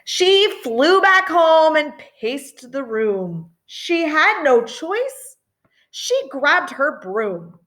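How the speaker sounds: lilting read-aloud voice; reading to children. A narrator's reading. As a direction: read it like a bedtime picture book. Story narration in rhyme.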